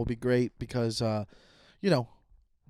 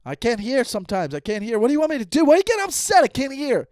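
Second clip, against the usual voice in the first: high, defensive dad shouting his excuse; pitched-up protest of a hard-of-hearing dad; exasperated dad; 8 semitones higher, 6 dB louder than usual. Retold or imitated. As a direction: imitate an upset father, building louder. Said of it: "That's what he'll do".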